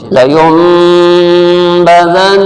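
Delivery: لینبذن very slowly, nasal hum on the ن; recitation with ghunna. Shown as a demonstration. Teacher reciting لینبذن slowly with nasal ghunna. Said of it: "نیلے colour پہ کھینچیں".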